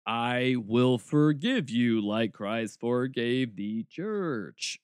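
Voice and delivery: very slow, mock-solemn recital of vows; robotic vows. Mocking a groom reciting his vows in church, slow and robotic. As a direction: drag out each word like a sterile, scripted vow. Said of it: "It's so robotic".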